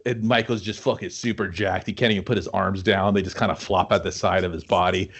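usual speaking voice

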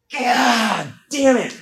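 drawn-out slow curse; frustrated. Retold or imitated. Comedian groaning after the lawn guy slams the gate, slow drawn curse. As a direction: stretch it out in mock frustration.

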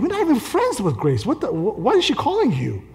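high-pitched mock indignation; indignant protest. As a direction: protest in comic disbelief. Retells his protest about the call.

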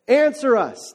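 shouted appeal, only partly let loose; mild shout. Acted. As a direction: call out for an answer, half-shouted. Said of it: "they shouted".

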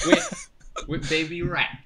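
put-on Cockney; Cockney accent. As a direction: say it like a Londoner. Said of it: "Why are, why are you doing that accent".

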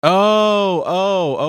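high, loud realizing oh; oh as it finally clicks; sudden realization. Real, not acted.

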